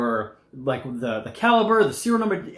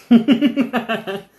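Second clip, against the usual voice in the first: laughing; 8 semitones higher, 6 dB louder, much slower than usual. Real, not acted. Laughs at car question.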